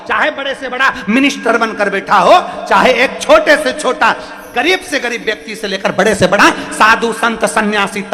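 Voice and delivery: high, loud rhetorical sermon; rousing preacher piling up challenges; full-force preaching. Real, not acted.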